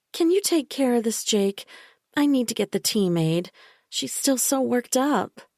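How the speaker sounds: a sickly-sweet caretaker who won't be argued with; Bella, falsely soothing. A narrator's reading. Bella insisting to the narrator that she hurt Michael, falsely soothing. Bella voiced as a higher figure.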